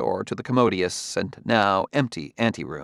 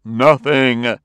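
louder, emphatic denial; forceful; 3 semitones higher, 8 dB louder than usual. A narrator's reading. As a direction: shut the question down with one forceful word. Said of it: "he said forcefully".

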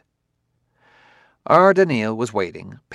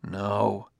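understated misery; miserable; 6 dB quieter than usual. A narrator's reading. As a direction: answer softly, a touch miserable. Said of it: "replied Bailey miserably".

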